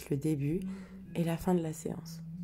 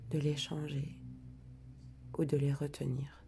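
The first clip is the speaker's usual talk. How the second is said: guided meditation. Acted. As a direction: ease listeners into calm, breath by breath. Guides the breathing and letting go.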